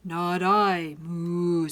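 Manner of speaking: loud refusal with a long moo; refuse, then moo; mooing cow. A narrator's reading. Cow turning the hen down, mooing loudly. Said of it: "said the cow".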